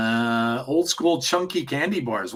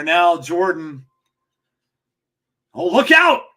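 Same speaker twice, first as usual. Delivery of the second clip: wrestling commentator. Real, not acted. Calls the wrestling match live.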